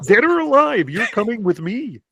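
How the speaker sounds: high nasal-free puppet frog voice, RoboCop line; Kermit the Frog voice. Retold or imitated. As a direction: Kermit doing a RoboCop threat. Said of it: "Kermit the Frog".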